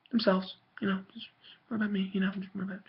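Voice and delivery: whispered, fading mock of selfish people. Mocking selfish people to viewers in a fading whisper. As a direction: breathe it out like a selfish aside, mocking.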